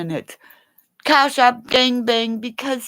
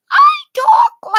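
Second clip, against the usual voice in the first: shrieking; 7 dB louder than usual. Real, not acted. Shrieks while describing.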